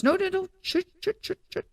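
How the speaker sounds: high, rapid no-no-no into shushes; imitated shushing. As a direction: cut them off with insistent shushing. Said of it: "She shushed me".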